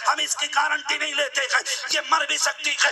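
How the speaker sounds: impassioned sermon building to loud peaks over music; full-force pulpit preaching about a mother's sacrifice; fiery preaching. Acted. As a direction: preach it hard to the young men. Preacher addresses youth about mothers.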